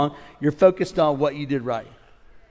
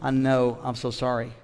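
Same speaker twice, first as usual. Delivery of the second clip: humble apology; 6 semitones lower, 9 dB quieter than usual. Retold or imitated. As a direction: ask politely, apologetic. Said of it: "I'd have said".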